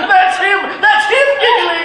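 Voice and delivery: high, loud laughing shout; laughing shout, a touch fast; laughing excitedly. Acted. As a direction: cry out with glee. Scrooge delighted watching game.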